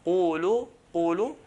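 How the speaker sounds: "qulu" heavy but rounded, just under "qala". Shown as a demonstration. Teacher showing qaf with dhamma, heavy "qulu". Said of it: "If qaf has a dhamma".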